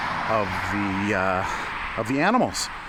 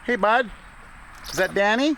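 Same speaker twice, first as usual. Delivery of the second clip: pet talk; 10 semitones higher than usual. Real, not acted. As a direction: coo at the little chipmunk. Greeting a chipmunk.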